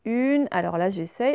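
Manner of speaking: ordinary talk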